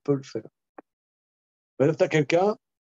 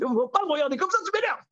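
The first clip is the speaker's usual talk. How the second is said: shrill irritation; much faster than usual. Retold or imitated. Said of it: "je suis nerveux".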